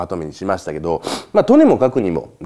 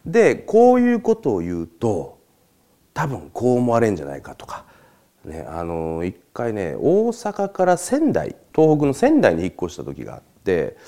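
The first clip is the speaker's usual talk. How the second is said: ordinary talk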